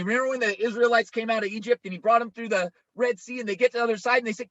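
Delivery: ordinary talk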